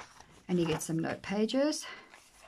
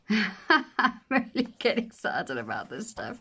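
laughing; 2 semitones higher, slower than usual. Real, not acted. Laughs at own enthusiasm.

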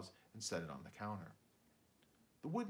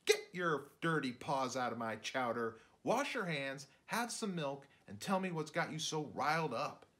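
mom scolding; 5 semitones higher, 4 dB louder than usual. Acted. Mom scolding dad in story.

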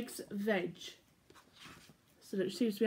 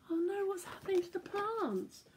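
worried character; 8 semitones higher, 5 dB quieter than usual. Acted. Character voices worry.

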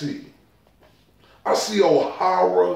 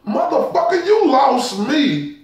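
exasperated outburst; 6 semitones higher than usual. Real, not acted.

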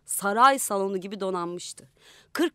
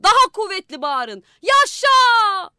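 loud, high rallying shout; 10 semitones higher, 11 dB louder, slower than usual. A narrator's reading. Young man urges the schoolchildren to cheer louder, shouting. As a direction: whip the children into cheering. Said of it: "Çocuklara bağırıyordu".

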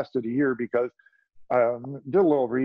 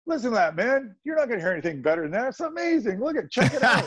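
much higher, gushing rave about a take; excited producer; 10 semitones higher than usual. Retold or imitated. Producer raving to the guitarist about his solo, high and gushing. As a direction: hype the solo up like a thrilled producer, laughing at the end. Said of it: "He goes".

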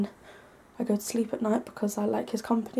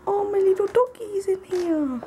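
high-pitched cooing at a dog; dog talk; 10 semitones higher than usual. Real, not acted. Spotting their little dog in the room, high-pitched dog talk. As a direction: gush over a pet in a high voice. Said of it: "they do this really high-pitched".

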